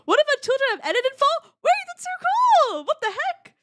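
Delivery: high, loud, fast excited chatter; excited squeal. Real, not acted. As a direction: gush with excitement. Excited at the news.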